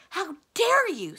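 high, loud shriek, drawn out slowly; outraged scream. A narrator's reading. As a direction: scream it in slow, towering outrage. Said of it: "screamed the enchantress".

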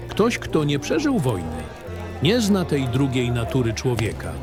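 plain reading voice

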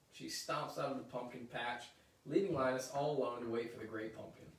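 plain reading voice

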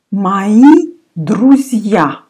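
Мои друзья, slow and clear, Мои said as "mai"; slow teacher's model of Мои друзья; slow model reading. Shown as a demonstration. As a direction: spell out Мои друзья slowly, о sounding like а. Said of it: "о I pronounce like а".

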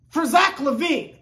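usual speaking voice

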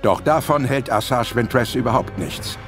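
ordinary talk

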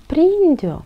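very high, loud command; urgent shout. A narrator's reading. Toni urges Albert to catch the magic, urgent shout. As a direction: yell to catch it. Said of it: "strigă Toni".

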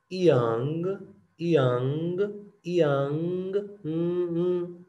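इयांग drawn out slowly, ending in a hummed ng; ng vibration demo. Shown as a demonstration. Teacher shows the इयांग hum. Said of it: "vibration, इयांग".

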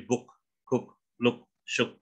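BOOK, COOK, LOOK, SHOOK with a brief u; short vowel. Shown as a demonstration. Coach demonstrating words ending in K, short vowel. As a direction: keep the oo short before each k. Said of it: "bacanya pendek".

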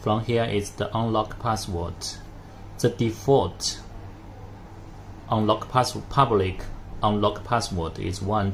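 ordinary talk, nothing marked

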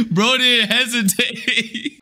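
high, slow words squeezed through laughter; laughing. Real, not acted. Laughing as the player leaves the game instantly. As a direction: laugh it out in disbelief.

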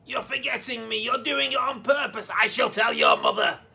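high, loud shrill granny scolding; angry grandma. Acted. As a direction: screech threats like a spiteful old woman. Grandma scolds from next room.

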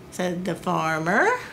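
ordinary delivery, nothing marked

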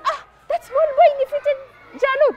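high-pitched marvelling voice; amazed gossip. Acted. Women in the market sharing the news, amazed.

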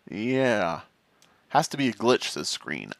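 ordinary delivery

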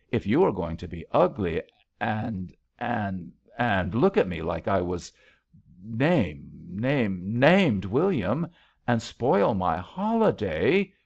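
ordinary delivery

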